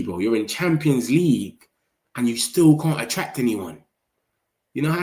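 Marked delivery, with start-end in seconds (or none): none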